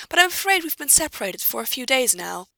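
high-pitched, courteous admission; polite, helpless. A narrator's reading. Pansy appealing to the knight for help, meekly polite. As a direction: plead gently, playing young and afraid. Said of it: "hoping she looks young and afraid".

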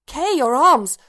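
high, loud startled cry; alarmed exclamation. A narrator's reading. Bodhi waking and seeing K two armless, alarmed. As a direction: blurt it out in sudden alarm.

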